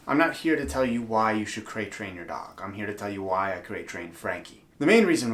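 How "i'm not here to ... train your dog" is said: ordinary talk, nothing marked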